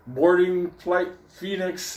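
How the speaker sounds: high, slow gate announcement; mock boarding announcer. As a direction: call the flight like a gate announcer, drawn out.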